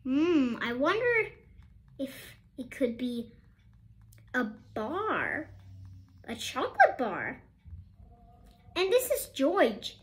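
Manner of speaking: plain reading voice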